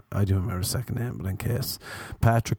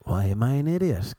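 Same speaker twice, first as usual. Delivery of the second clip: ordinary talk, nothing marked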